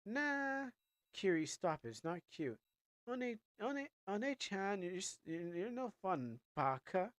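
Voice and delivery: whiny anime sister. Acted. Voicing a whiny anime sister character.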